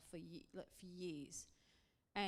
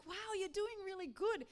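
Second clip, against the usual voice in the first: high, bright gushing praise, much higher and louder; excited praise; 12 semitones higher, 5 dB louder than usual. Retold or imitated. Wife cheering her husband singing in key, excited. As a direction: gush over him like a proud cheerleader. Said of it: "really excited".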